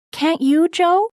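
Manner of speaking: put-on high, gentle young girl's voice; Beth's voice. Narrator voices young Beth.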